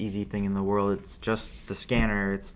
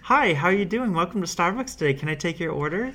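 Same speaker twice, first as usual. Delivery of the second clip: higher, chirpy Starbucks greeting; sing-song higher barista welcoming a customer; customer service voice; 8 semitones higher, 3 dB louder than usual. Retold or imitated. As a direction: put on the fake-friendly barista voice. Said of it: "the customer voice".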